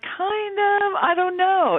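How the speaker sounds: high-pitched, drawn-out hedging; unsure answer. Retold or imitated. Friend asked to explain a slang term, doubtfully hedging.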